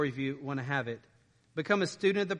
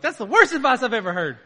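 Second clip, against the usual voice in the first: laughing loud and high as a doubting listener; laughing mock objection; 8 semitones higher, 11 dB louder than usual. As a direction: burst out laughing at the bad advice. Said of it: "Someone's thinking, like".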